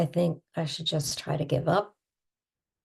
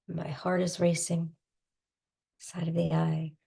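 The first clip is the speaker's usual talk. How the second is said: guided tapping script. Acted. Guiding listeners through tapping, calm soothing tapping voice. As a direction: talk them gently through each tapping point. Leads an EFT tapping round.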